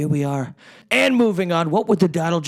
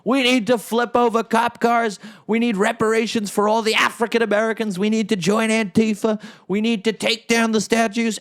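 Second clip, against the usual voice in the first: fake Trump voice; 6 semitones higher than usual. AI Trump pandering to the BLM crowd, hammed-up higher voice. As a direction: put on a fake AI Trump rallying the left. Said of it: "They'll have a fake Trump saying".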